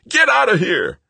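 furious high-pitched shout; very loud, enraged yell; screamed. A narrator's reading. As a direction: scream the order at an intruder. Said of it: "Greg screamed at him".

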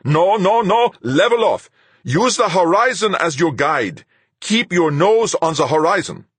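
high, urgent shouted commands; yelling. A narrator's reading. Fuchs suddenly yells at the pilot to level off, yelling. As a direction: yell flight orders over the radio. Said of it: "Suddenly Fuchs yelled".

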